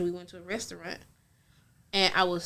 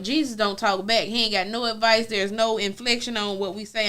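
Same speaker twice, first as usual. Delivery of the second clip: normal talking voice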